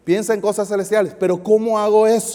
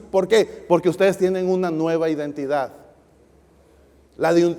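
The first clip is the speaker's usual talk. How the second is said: ordinary talk, nothing marked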